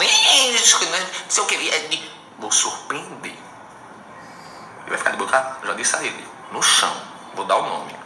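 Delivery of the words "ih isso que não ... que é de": mocking critics. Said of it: "ninguém me falando".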